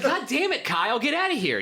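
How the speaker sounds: put-on bratty kids. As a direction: boss the little kid off. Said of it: "we'd be like".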